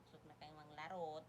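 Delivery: ordinary talk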